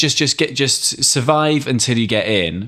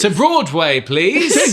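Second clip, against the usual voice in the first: laughing; 9 semitones higher, 4 dB louder, much slower than usual. Pretend. Mock cab order, laughing.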